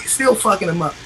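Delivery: normal talking voice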